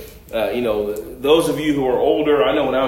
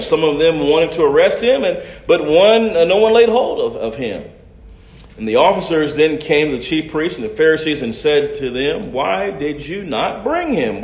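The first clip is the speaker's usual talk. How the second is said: scripture reading; 4 semitones higher, 4 dB louder than usual. Acted. Preacher reads the scripture aloud.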